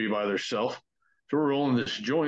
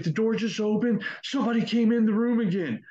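much higher, breathless frightened rush; 10 semitones higher than usual. Retold or imitated. Janiyah telling friends after the door opened, terrified. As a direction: blurt it out, barely holding together.

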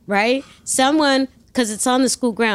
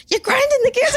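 squeaky high friend voice, laughing through it; mock friend voice; faster than usual. Friend warns about grinding gears, laughing squeaky voice. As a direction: play the passenger scolding the learner in falsetto. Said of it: "He goes".

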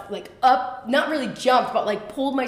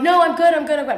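high, louder mock-insistence; acted reassurance; 6 semitones higher, 7 dB louder, much faster than usual. Hurt woman hiding tears from the boat crew, over-insistent reassurance. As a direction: imitate brushing off concern, pitched up. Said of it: "I'm trying not to cry".

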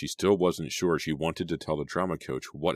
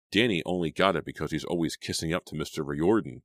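ordinary delivery

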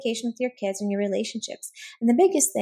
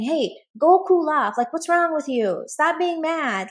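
louder, higher, hammed-up irritation; mock scolding; 6 semitones higher, 6 dB louder than usual. Husband reacting badly to wife's hard moment, mock scolding. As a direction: play the unsympathetic spouse telling someone off. Acting out the unhelpful scolding spouse.

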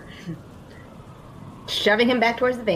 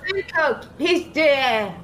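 mock yell out; 4 semitones higher, much slower than usual. Player announcing to the guard, half-hearted yelling out. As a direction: call it out across the lot, pitch up. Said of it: "I just yell out".

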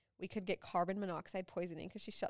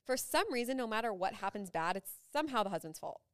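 ordinary talk

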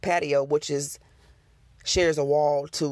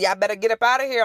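loud warning; 5 semitones higher, 5 dB louder, faster than usual. Retold or imitated. Ordering the unseen presence out.

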